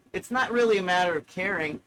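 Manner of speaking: normal talking voice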